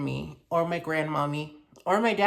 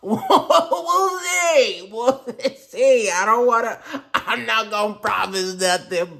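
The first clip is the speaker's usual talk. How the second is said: laughing, higher and louder; 5 semitones higher, 4 dB louder, slower than usual. Real, not acted. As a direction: giggle through it. Playful laughing hedge.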